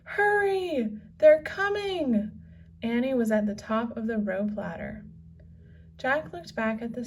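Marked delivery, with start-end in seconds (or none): 0.0-2.3 high, loud urgent call to hurry; a girl yelling for her brother to hurry up. A narrator's reading. Annie warns Jack pirates are coming, high urgent shout.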